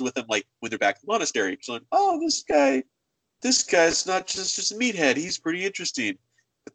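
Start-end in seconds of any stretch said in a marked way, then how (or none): 1.9-6.2 high-pitched, pleasantly surprised character; mock pleasant surprise. Character chatting in the monastery, pleasantly surprised. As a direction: open high, then settle into approval. Acted villager impressed by a friend.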